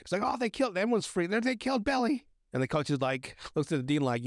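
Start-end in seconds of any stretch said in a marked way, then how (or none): 0.2-2.2 mock distraught. Cheerleader reports Billy's death.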